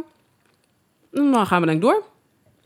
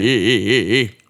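deep low voice; 9 semitones lower, 6 dB louder than usual. Pretend. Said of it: "Dat is beter".

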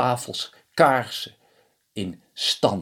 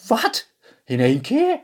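high, loud startled yelp; shocked; 13 semitones higher, 3 dB louder than usual. A narrator's reading. Reynold hears of four children, shocked and high. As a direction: jump up in disbelief.

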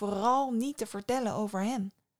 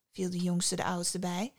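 ordinary delivery, nothing marked

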